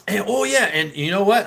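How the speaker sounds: ordinary talk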